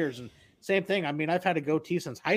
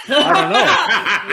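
high, loud, drawn-out retold reply; mock baffled cry; 10 semitones higher, 11 dB louder, much slower than usual. Friend's wife baffled by the stranger, mock baffled cry. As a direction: exaggerate her bafflement, slow and loud.

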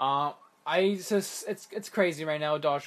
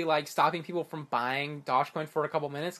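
ordinary talk, nothing marked

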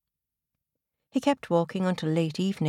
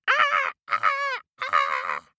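eagle screech; 8 semitones lower, much slower than usual. A narrator's reading. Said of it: "screeched like an eagle".

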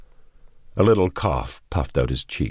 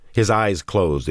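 ordinary delivery

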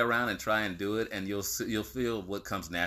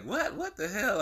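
high-pitched baffled reaction; imitated confusion, pitched way up; mock bafflement; 11 semitones higher, slower than usual. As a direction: act out hearing a baffling word. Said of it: "And I'll be like".

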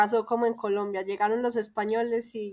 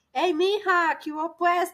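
a light Paisa accent, higher and punchy; Paisa greeting; 6 semitones higher than usual. Shown as a demonstration. Showing the Mexican host her Paisa accent, lightly higher. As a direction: show off how Paisa people greet, a bit higher. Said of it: "como que hablamos muy fuerte".

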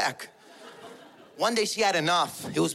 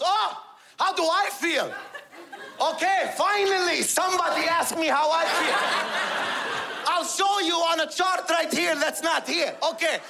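high-pitched immigrant dad's venting tirade; exasperated father; 7 semitones higher than usual. Retold or imitated. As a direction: vent a lifetime of grievances, pitched high. Father's acted outburst about his feelings.

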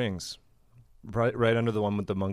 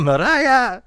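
high Mariah note; 14 semitones higher, 8 dB louder than usual. Retold or imitated. Imitating [name].

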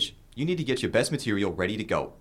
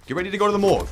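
higher, loud menacing threat; 6 semitones higher, 7 dB louder, much faster than usual. Acted. Producer threatening the brawlers with a gun, loud. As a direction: bark the threat at them.

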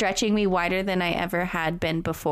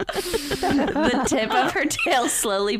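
higher, slow, laughing through it; 8 semitones higher, slower than usual. Real, not acted. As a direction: read while still giggling. Reads while still laughing.

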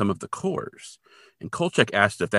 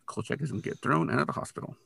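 normal talking voice

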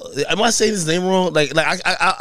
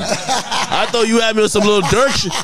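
laughing; 6 semitones higher than usual. Real, not acted. Laughing at the misunderstanding.

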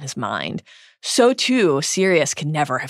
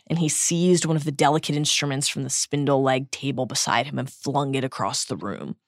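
normal talking voice

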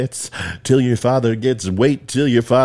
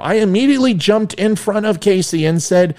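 usual speaking voice

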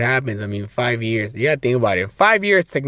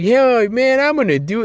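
put-on excited kid; 10 semitones higher than usual. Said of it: "I'm like".

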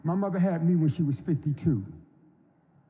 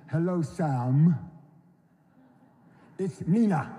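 slow, drawn-out greeting, lightly voicing his sister; a light take on a famous singer's slow hello; slower than usual. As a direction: stretch each word like his sister does on the phone. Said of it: "she talks like this".

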